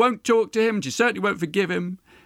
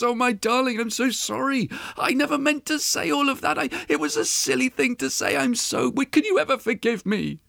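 high-pitched, faltering begging; pleading apology; 6 semitones higher than usual. A narrator's reading. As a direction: beg desperately for forgiveness. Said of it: "he pleads and he begs and he apologizes".

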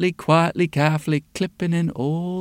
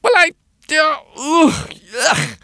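gasping distress; 11 semitones higher, 4 dB louder than usual. A narrator's reading. Old woman overwhelmed, gasping.

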